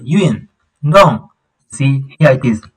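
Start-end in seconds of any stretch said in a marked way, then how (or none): 0.0-1.3 'Yuen Dong' higher, each syllable falling steeply; falling tones. Shown as a demonstration. Teacher demonstrates the falling tone, steep falls on 'Yuen Dong'. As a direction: hit 'Yuen' and 'Dong' high, then drop each. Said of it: "You see the falling tone".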